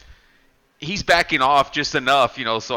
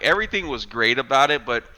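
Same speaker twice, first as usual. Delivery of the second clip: ordinary delivery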